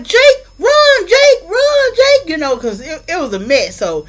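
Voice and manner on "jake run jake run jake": much higher, loud crying shout; frantic scream. Retold or imitated. Bella begging Jake to run from the fight, panicked crying shout. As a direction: scream his name in panic, voice cracking.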